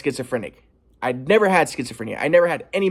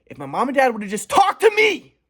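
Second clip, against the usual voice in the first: ordinary delivery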